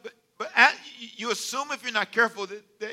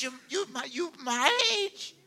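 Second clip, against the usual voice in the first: drawn-out, higher, near crying disbelief; aghast disbelief; 6 semitones higher than usual. Retold or imitated. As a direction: stretch it out in horrified disbelief. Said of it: "I said".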